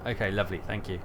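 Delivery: ordinary delivery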